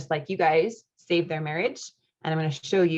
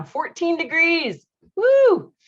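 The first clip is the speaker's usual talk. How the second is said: high, slow fourteen degrees then a loud high woo; mock brr excitement; 11 semitones higher, 7 dB louder, much slower than usual. Real, not acted. Host reacting to a viewer's chilly UK weather, high excited woo. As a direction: shout woo as if feeling the cold.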